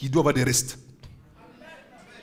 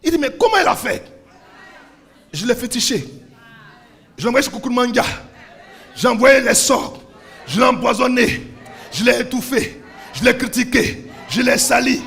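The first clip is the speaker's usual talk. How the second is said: loud, rising preacher's cry to the crowd; fiery sermon delivery; full-force preaching; 6 semitones higher, 6 dB louder than usual. Acted. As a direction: preach it out, pushing each repeat harder.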